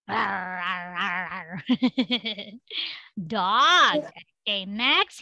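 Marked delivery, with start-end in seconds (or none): 0.1-1.6 low soft mock growl, fading. Shown as a demonstration. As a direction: growl like a playful dog. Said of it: "Can you sound like a dog?".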